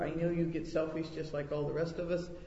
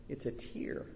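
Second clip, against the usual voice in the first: hushed, much softer, almost breathed; tender; 3 semitones lower, 10 dB quieter than usual. A narrator's reading. Lord tenderly telling the angel, soft near-whispered reveal. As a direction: whisper it like a secret of love.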